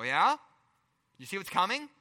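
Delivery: ordinary delivery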